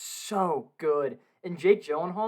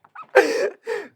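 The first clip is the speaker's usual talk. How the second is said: burst of laughter; 10 dB louder than usual. Real, not acted. Enjoying friend's pain.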